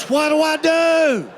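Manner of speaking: high, slow panicked shout; a wife who has hooked a cow, calling for help. Retold or imitated. Wife hooked a cow and yells to husband, high panicked shout. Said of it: "looks over and goes".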